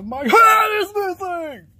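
loud, high, drawn-out cry of alarm; alarmed yell. Acted. Discovering the head is missing, alarmed yell.

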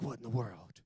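low, soft mock bafflement; hushed imitation of bewilderment; retold bewilderment. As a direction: mumble it as if quietly stunned. Acts out his startled reaction.

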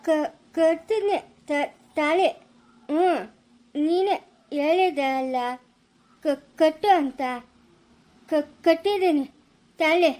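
halting stutter with long gaps; word-by-word stammer. Acted. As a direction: stick on the first sound, then push it out. Stammering man justifies tying the thali.

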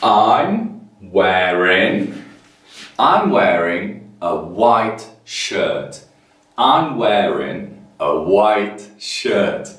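slow, clear model sentence for the class. Shown as a demonstration. Teacher modelling slowly for young learners. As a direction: spell each word out slowly. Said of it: "So you say".

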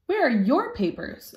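high, haughty poodle voice; snooty show poodle sneering; prissy poodle. A narrator's reading. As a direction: look down your nose at the new pup. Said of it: "a poodle with a pink ribbon".

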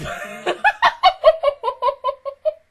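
long, high-pitched cackle; high laughing fit. Real, not acted. Laughing about dozing off mid-movie, high cackle. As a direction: burst into high, rapid giggles.

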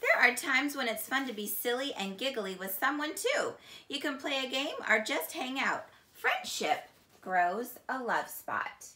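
normal talking voice

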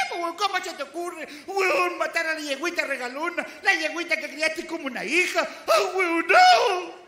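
high, indignant wife telling off her husband; scolding. Acted. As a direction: scold him, building to a loud outburst. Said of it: "Lo reté".

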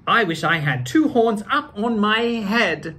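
lively storybook reading; reading to children. A narrator's reading. Actor reading Doctor Seuss to children in a lively voice. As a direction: read it out like story time for kids. Reads book aloud.

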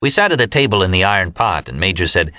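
ordinary delivery

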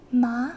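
"Ma" with the pitch gliding up at the end; first tone. Shown as a demonstration. Teacher demonstrating a tone to beginners, rising "Ma". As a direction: say "Ma" loud, lifting into a rise. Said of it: "First tone looks like this".

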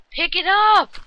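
sudden loud, high yell; frustrated gamer shouting at the game. Real, not acted. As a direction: yell at the screen to grab it. Urging the game to collect items.

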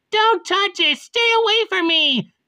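very high-pitched angry yell. Acted. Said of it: "Nellie just yelling Tiffany".

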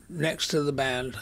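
ordinary talk

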